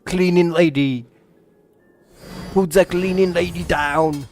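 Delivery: mock action-hero command in Austrian accent; attempted Austrian movie-star voice; Arnold Schwarzenegger. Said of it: "I'm gonna sound exactly like Arnold Schwarzenegger".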